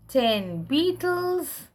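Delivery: ordinary talk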